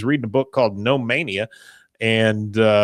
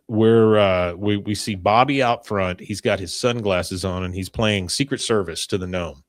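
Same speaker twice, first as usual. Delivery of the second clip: ordinary talk, nothing marked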